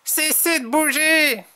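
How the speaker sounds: high, exasperated little order; annoyed Tom. A narrator's reading. Tom tells the rabbits off, high annoyed voice. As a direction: order them high, out of patience. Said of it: "s'énerve Tom".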